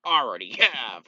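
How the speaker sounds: loud, punchy retort; exasperated shout. Acted. Father answering back at the mother, exasperated shout. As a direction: fire it back at full volume. Locke snaps back at Laura.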